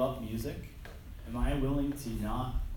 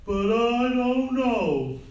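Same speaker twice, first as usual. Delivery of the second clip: very slow, much higher and louder dopey voice; slow-witted Steve Martin; 10 semitones higher, 10 dB louder, much slower than usual. Retold or imitated. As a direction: drag it out like the simple-minded Jerk. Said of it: "That's the way he talks".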